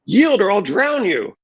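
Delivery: high, slow, furious threat; threatening shout. A narrator's reading. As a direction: spell out the threat. Brienne threatens to drown him.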